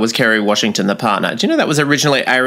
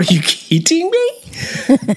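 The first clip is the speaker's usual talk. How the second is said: high, drawn-out mock disbelief; retold disbelief; much slower than usual. Viewer seeing an hour still to go, incredulous.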